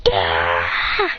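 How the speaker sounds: very low growled yell; low berserk roar; berserk cry. Acted. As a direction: growl out a wild attack cry. Character going berserk in fight.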